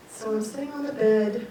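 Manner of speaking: usual speaking voice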